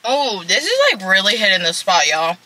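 speaking with food in mouth; talking while chewing. Real, not acted. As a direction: mouth partly full mid-bite. Seen 3: cheeks full, jaw working after bite taken at -2s.

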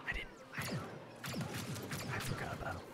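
barely audible whisper; whispered aside. Pretend. Player confesses after gushing to a returning friend, whispered aside. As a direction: drop to a secret whisper.

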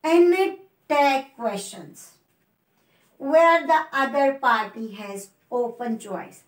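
very slow, each word spaced out; slow dictation. Shown as a demonstration. Teacher reading the rule slowly to the class. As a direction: read it out slowly for students to copy. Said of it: "next step देखिए".

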